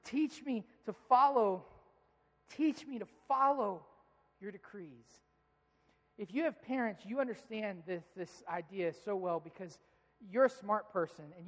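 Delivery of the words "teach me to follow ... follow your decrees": pleading prayer. Acted. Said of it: "the author of this verse says".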